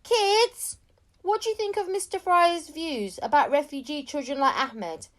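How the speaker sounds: loud, high shout across the street; reporter shouting. A narrator's reading. Reporter shouting questions at the kids, loud and high. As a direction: call out to the kids over the crowd. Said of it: "a reporter suddenly shouted out".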